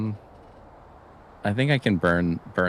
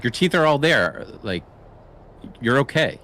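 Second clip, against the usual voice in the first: raised, insistent reassuring; urgent reassurance; 7 semitones higher, 5 dB louder than usual. Acted. Player's character urging the frantic doctor, raised and insistent. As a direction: talk him down fast and firm. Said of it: "I'm like".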